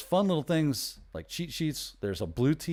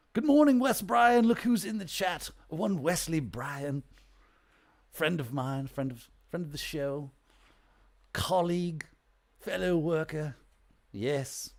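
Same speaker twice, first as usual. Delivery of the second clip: posh stuffy professor; 6 semitones higher than usual. Pretend. Hammed-up posh professor voice welcoming a friend in the chat. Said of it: "your best posh, stuffy professor voice".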